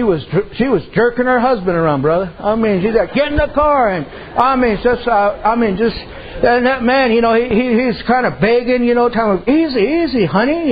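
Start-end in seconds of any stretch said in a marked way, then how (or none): 3.1-3.9 high, sharp imitation of a pushy wife; bossy wife. Wife ordering her husband into the car, mock bossy. 9.5-10.7 high, pleading mock husband voice. Husband begging his wife to calm down, mock pleading.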